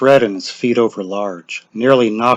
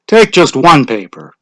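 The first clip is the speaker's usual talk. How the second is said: official voice; 4 semitones higher, 7 dB louder than usual. Acted. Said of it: "Mister Summers said".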